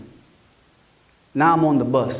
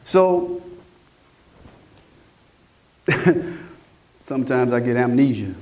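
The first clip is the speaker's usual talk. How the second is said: normal talking voice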